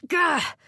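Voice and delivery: sudden high exasperated "Gah!"; frustrated groan. Acted. Woman shaking off memories, exasperated high yelp. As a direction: snap out of a daydream with a yelp. Frustrated at her own thoughts.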